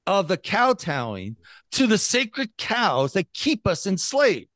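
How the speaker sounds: ordinary talk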